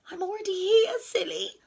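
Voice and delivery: lightly squeaky, starting high; mouse squeak. A narrator's reading. Mouse corrects frightened Rabbit, light squeak. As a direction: pipe up with a small squeak. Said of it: "squeaked Mouse".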